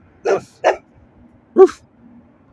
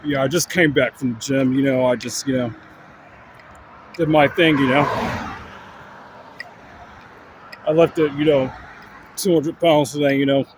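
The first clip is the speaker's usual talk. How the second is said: bodybuilder dude voice; 2 semitones lower than usual. Pretend. Continues dog-as-bodybuilder voice.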